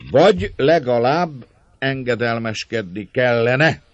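hangos, magas, lassan nyújtott helyesbítés; nyújtva, emelt hangon javítsd ki magad; önmagát helyesbítő szónok. A narrator's reading. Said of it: "igazíták ki magát a szóló". (magát kiigazítva)

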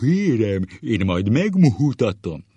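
high, loud, booming threat; hammed-up teacher shout; muddled teacher impression. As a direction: bellow it, mixing two schoolmasters' voices. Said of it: "Összezavarja a sárgaság és falkaparó hangot".

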